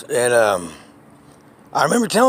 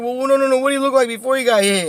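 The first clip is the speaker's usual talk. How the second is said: put-on upset old lady; 10 semitones higher, faster than usual. Old lady upset about her cat in the trash, higher. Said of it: "she started getting upset".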